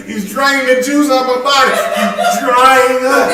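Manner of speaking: high, loud words through laughter, the last line slowed; laughing loudly. Real, not acted. Preacher laughing hard describing the friend's breath. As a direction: push the words out while cracking up. Comic bad-breath story.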